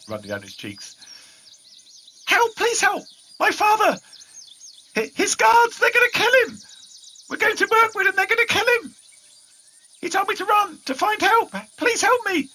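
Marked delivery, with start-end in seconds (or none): 2.2-4.0 put-on much higher, louder, frantic little boy; panicked child. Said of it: "his words tumble out in a flood of panic and tears". 4.9-6.6 put-on much higher, louder tearful boy's cry; panicked child. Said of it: "his words tumble out in a flood of panic and tears". 7.3-12.5 put-on much higher, louder, frantic little boy; panicked child. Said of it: "his words tumble out in a flood of panic and tears".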